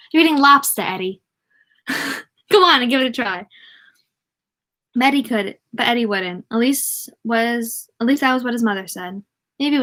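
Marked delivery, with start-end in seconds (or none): 0.1-3.5 hammed-up Maine accent, higher and louder on LOBSTER; Down East accent. Shown as a demonstration. Mother urging Eddie to eat lobster, in hammed-up Down East accent. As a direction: put on a Down East accent, amused at it. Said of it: "I wanted to say that in the accent".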